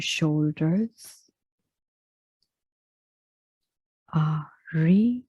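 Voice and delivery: very slow meditation voice with long pauses; unhurried body scan, each phrase left to settle; guided relaxation. Real, not acted. Yoga teacher guiding listeners into final relaxation, slow meditative delivery.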